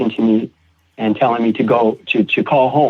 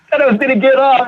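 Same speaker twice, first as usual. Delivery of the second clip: high-pitched, amused through a laugh; real laughter spilling into the words; 13 semitones higher, 3 dB louder than usual. Real, not acted. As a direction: laugh at your own bad luck. Laughs at own dashed hopes.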